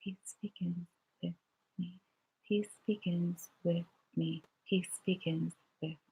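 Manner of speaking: barely audible murmur, almost silent; silently to yourself. Shown as a demonstration. Children say the mantra silently to themselves. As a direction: say it to yourself under your breath. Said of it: "And now say it to yourself".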